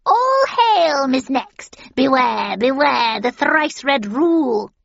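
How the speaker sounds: high, loud crone proclamation; proclaiming witch. A narrator's reading. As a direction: hail her like a prophesying hag.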